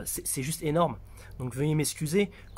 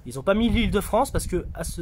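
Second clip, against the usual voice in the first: ordinary talk, nothing marked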